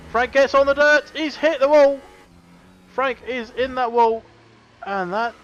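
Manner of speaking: loud, high crash call; excited commentator. Real, not acted. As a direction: yell as the leader hits the wall. A leader crashing during the race.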